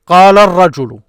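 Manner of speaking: "قال الرجل" loud and linked as qaalar-rajulu; projected demo of "قال الرجل" with the L silent and R doubled; words joined. Shown as a demonstration. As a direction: run "قال الرجل" together as one word. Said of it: "This is what you hear".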